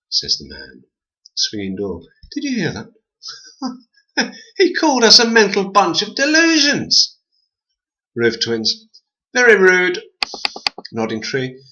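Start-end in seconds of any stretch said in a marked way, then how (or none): 2.3-7.2 high, giggly little twin voice; squeaky twin voice. A narrator's reading. Twins mocking the man. 9.3-10.0 high, prim little voice; prissy twin. A narrator's reading. Twins tut at the man.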